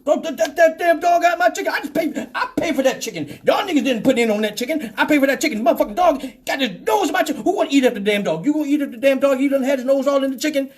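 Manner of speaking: high, fast, sputtering complaint; indignant ranting. Acted. Passenger protesting the dog got his chicken, indignant. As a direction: rattle off a worked-up mock protest.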